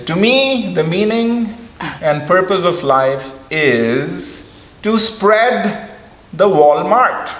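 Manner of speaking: slow, high imitation with an accent that sounds like Walmart; His Holiness's accent. His Holiness saying warm heart in his accent, slow and high. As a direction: draw out spread the Walmart, high. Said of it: "And then he says".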